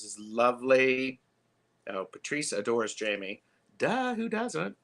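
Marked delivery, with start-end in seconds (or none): 3.8-4.7 higher, teasing duh of the obvious; playful duh. Real, not acted. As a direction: tease it like everyone knows. Teasing aside about Patrice loving Jamie.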